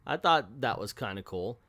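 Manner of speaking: ordinary talk, nothing marked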